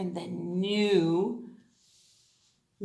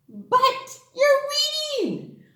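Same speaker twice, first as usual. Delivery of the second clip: high, slow, delighted; excited praise; 4 dB louder, slower than usual. Acted. Teacher delighted the kids read a word, high and slow. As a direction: beam with pride at the reader.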